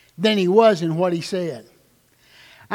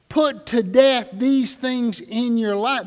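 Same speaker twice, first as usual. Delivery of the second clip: slow deliberate Put to death; serious, slow quote; slower than usual. Shown as a demonstration. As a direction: read it like it matters. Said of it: "that's a serious, serious thing".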